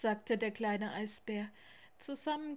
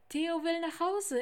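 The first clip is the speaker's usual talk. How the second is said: raised voice; 8 semitones higher, 7 dB louder than usual. Acted. Lars explains loudly to giant owl.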